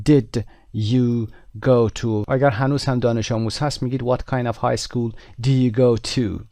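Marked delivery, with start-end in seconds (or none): 0.1-2.2 you go to said very slowly and clearly; very slow model question. Shown as a demonstration. Teacher drills the question slowly for the class. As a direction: drag out the end of the question.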